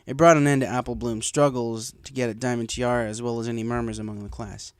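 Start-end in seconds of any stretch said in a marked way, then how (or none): none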